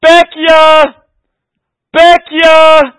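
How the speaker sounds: loud, high long street cry; street vendor's call. Retold or imitated. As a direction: call out to the whole street like the junk buyer. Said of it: "And the sound was this".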